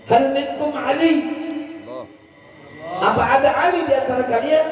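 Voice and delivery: high searching call; high, hopeful asking; eager traveller. Acted. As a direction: call out over the crowd for Ali.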